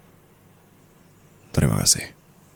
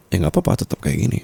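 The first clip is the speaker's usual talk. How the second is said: normal talking voice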